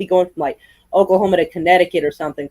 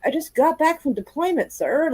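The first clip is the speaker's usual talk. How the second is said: put-on higher, timid voice of the nerdy kid; meek young marine; 8 semitones higher than usual. Nerdy kid answering the grumpy dad, higher and timid. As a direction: answer the grumpy father-in-law meekly. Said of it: "He goes".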